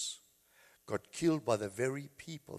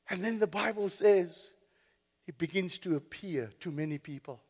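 normal talking voice

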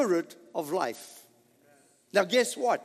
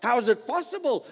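usual speaking voice

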